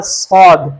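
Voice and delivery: صاد said thick and heavy, with a full emphatic s; heavy Saad demo. Shown as a demonstration. Teacher models صاد for students: heavy emphatic sound. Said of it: "اس کو ہم نے ہمیشہ موٹا پڑھنا ہے".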